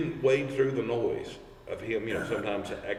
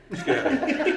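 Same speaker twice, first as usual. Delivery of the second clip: laughing quote; 3 dB louder than usual. Retold or imitated. Mimicking Wayne's delight.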